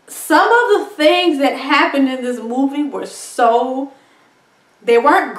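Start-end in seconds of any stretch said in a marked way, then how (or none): none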